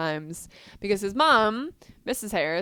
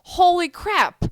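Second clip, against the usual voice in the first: shocked realization; 7 semitones higher, 6 dB louder than usual. Retold or imitated. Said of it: "you're like".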